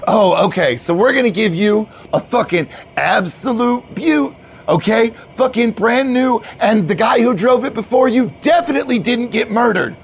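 high, emphatic bro pitch; hyped-up bro, salesy. Acted. Manager shows new hire a vehicle, salesy bro. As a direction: talk up the vehicle eagerly.

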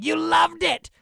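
high, loud, unhinged yell; a crazed retold shout at her; psychotic yell. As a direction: scream it like an angry jock. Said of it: "he's just yelling".

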